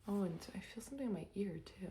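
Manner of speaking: hushed whisper. Real, not acted. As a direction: whisper it to yourself. Sudden hushed aside.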